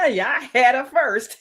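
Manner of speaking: high mocking laugh through the words; hammed-up jeering cackle around a boast; imitated taunting laughter. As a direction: laugh it out like a gloating rival. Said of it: "like laughing at it".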